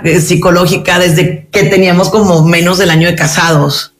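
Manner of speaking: usual speaking voice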